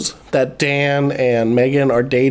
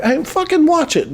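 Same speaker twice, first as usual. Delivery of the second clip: high, indignant retort, half in play; mock warning; 11 semitones higher than usual. Imitating Dan defending his fiancee, mock warning. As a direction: imitate a protective warning with raised pitch.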